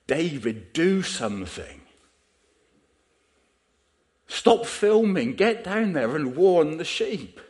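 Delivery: loud, high-pitched desperate yell; acted-out urgent shout, drawn out and rising in force. Preacher re-enacting himself shouting at the TV, urgent high yell. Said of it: "I'm shouting at the, the T V".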